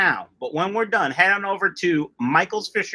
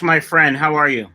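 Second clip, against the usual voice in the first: ordinary talk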